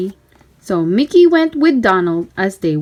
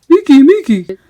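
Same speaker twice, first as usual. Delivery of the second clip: louder goofy cartoon shout; 3 semitones higher, 7 dB louder than usual. A narrator's reading. Goofy shouting for Mickey, louder goofy voice. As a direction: call him urgently, loud and goofy. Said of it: "Goofy shouted".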